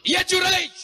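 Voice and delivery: high loud shout of the name; mother's third desperate call. Acted. (ibu berteriak memanggil Juraij)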